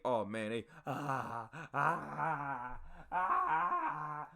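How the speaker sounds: mock pained groaning, rising; imitating groans. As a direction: imitate recruits moaning through the workout. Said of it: "Everybody moaning and groaning in pain".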